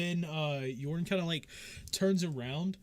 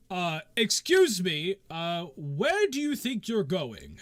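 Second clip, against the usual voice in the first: slow, louder guard voice challenging the wagon; 4 semitones higher, 6 dB louder, slower than usual. Acted. Guard stopping the wagon, slow and loud. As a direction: halt them with a slow questioning call.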